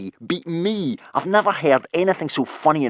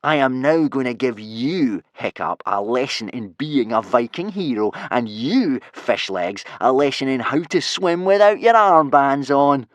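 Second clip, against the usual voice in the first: slow, gloating threat, building louder; menacing bully; 4 semitones higher, 5 dB louder than usual. Acted. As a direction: announce the punishment with relish. Bully threatens his victims.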